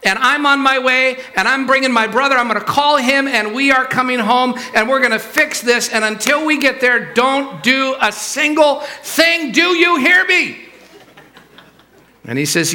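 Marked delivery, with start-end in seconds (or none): 0.0-10.6 high, forceful orders down the phone; hammed-up furious daughter; screaming at dad. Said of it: "starts screaming".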